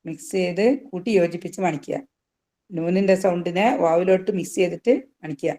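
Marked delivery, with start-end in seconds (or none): none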